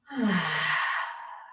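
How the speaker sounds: long audible exhale; big exhale sigh. Shown as a demonstration. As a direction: let out a full sighing breath. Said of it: "Big exhale".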